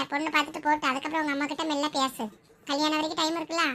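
usual speaking voice

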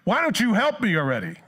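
high, loud fed-up demand; exasperated outburst. Retold or imitated. Worker fed up with lazy coworker, loud exasperated. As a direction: let your frustration burst out.

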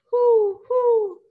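high, loud hooting owl call. Acted. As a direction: hoot the words like an owl to the kids. Owl hoots in picture book.

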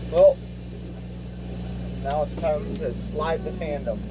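normal talking voice

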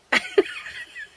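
high-pitched giggling fit; uncontrolled high laugh; helpless laughter. Real, not acted. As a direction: squeal with laughter. Pet brings toilet roll.

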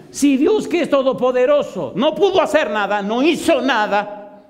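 high-pitched, emphatic outcry; preacher's heated re-enacted challenge; impassioned argument. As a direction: drive the point home at a high pitch, dragging the last words. Climax of retold argument with hitman.

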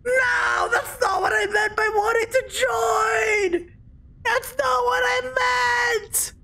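high, drawn-out cry of dismay; wailing protest. Real, not acted. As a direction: wail it slowly, stretching the words. Game goes wrong, wails in protest.

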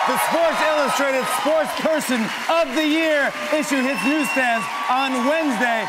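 booming broadcast plug, pitched high; announcer promo read. Acted. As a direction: sell the show like a TV promo.

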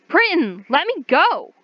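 high, loud demand to be freed. Acted. Captive demands Britton release her, shouting. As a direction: shout at him to let go. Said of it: "you demanded".

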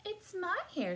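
high, tiny-animal squeak; squeaking guinea pig. A narrator's reading. Guinea pig owning up to its hair, squeaking. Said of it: "squeaked the guinea pig".

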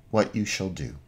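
ordinary talk

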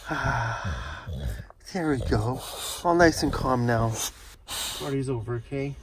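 quiet, calming murmur; soft soothing. Real, not acted. Owner calming the dog after play, soft and soothing. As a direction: settle him down gently.

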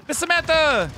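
high-pitched call-out; sudden high, delighted hail; excited greeting. Real, not acted. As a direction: sing out a viewer's name in welcome. Greeting a viewer by name.

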